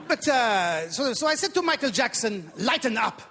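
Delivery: put-on comic Iranian accent, eager to please. Comedian playing Iranian immigrant to the audience, put-on Iranian accent. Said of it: "a bit of an accent I do at the beginning".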